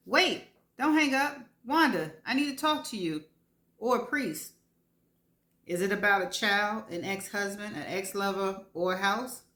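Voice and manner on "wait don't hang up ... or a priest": higher, louder urgent plea; urgent call. Acted. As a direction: stop her hanging up. Calling out before hang up.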